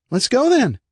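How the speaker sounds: put-on high, bright-eyed kid whooping then chatting; little brother bragging about his fall; cheerful little boy. Eric voiced as a boy.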